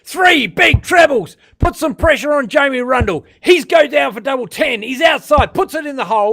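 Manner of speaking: shouting, high, slowed commentary; excited commentary. Real, not acted. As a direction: roar the big trebles out. Commentator erupts at big finish.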